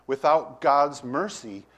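ordinary talk

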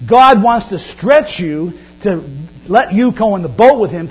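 usual speaking voice

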